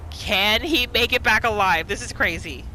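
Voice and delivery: ordinary talk, nothing marked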